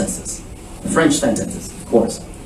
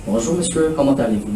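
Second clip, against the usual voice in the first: flat, softer rote recitation, pitch dropping; recited from memory; 3 semitones lower, 7 dB quieter, faster than usual. Shown as a demonstration. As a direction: rattle it off like a memorized text. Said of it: "No. I want you to listen".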